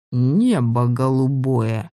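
slow, drawn-out amazed exclaim; surprised exclamation. A narrator's reading. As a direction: marvel at it slowly. Detective whistles in surprise.

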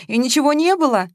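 a touch of weepiness; tearful. A narrator's reading. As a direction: ask hopefully through tears. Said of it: "всё ещё всхлипывала Маша".

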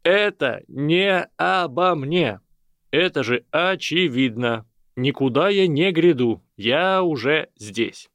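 loud, high, slow announcement over the crowd. A narrator's reading. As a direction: shout it over the noisy hall. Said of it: "очень громко, перекрикивая все начавшиеся разговоры".

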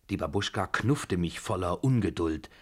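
ordinary delivery, nothing marked

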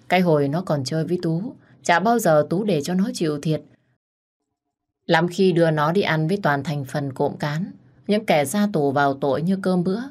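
ordinary delivery, nothing marked

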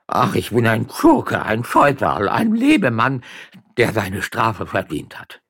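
slow, high theatrical lament; loud wailing. Acted. Magistrate hoping tears will save him, wailing loudly. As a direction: wail and heap blame on yourself. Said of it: "So jammernd hielt er sich".

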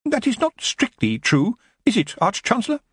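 high, hesitant assistant; nervous underling's high voice; timid objection. Acted. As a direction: pipe up cautiously to correct a superior. Timid assistant objects to his superior.

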